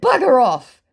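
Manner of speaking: playful retold shout; mock yell. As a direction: bark it at him in retelling. Telling scammer off.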